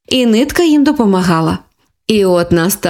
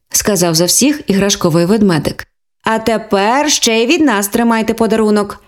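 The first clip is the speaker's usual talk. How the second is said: ordinary delivery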